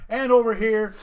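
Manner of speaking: loud announcer intro. Pretend. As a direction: boom it out like a ring announcer. Announcing guest.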